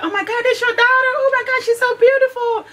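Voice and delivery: very high-pitched squealed gushing; gushing admirers. Retold or imitated. People complimenting the daughter at school, gushing. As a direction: imitate strangers cooing over a pretty girl.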